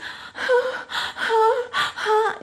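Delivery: high, heaving sob breaths; imitated crying gasps. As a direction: act out a child sobbing for air. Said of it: "just crying".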